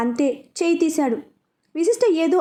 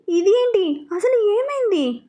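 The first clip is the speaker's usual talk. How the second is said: alarmed and high, slowing on అసలు ఏమైంది; panicked questions over his injury; anxious; 7 semitones higher, slower than usual. A narrator's reading. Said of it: "అని అడిగింది కంగారుగా". (కంగారుగా, ఆందోళనగా)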